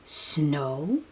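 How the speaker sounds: ordinary delivery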